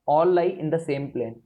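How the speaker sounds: all lie in the same plane, each word stretched; very slow dictation. Shown as a demonstration. Teacher dictates the law's second part to students, very slowly. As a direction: let the class write every word.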